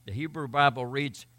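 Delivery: normal talking voice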